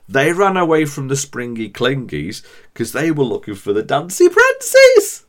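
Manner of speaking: high, bouncy, playful voice for made-up critters; playful silly storyteller. Acted. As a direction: tease the listener like a kids' show host. Silly playful nonsense-creature bit.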